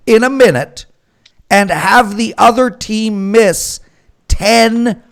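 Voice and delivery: venting disbelief at the math, each step heavier; exasperated rant. Real, not acted. Host ranting to co-hosts about an impossible comeback, exasperated. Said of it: "I can find a rant out of anything".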